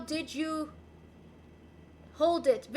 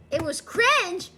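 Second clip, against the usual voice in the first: 1 mock outrage; 7 dB louder, slower than usual. Real, not acted. Judging own French attempt.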